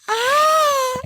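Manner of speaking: very high, loud, sustained mock scream; imitated scream. Retelling how the woman runs away from the kid, high mock scream. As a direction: shriek one long high note. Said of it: "she goes".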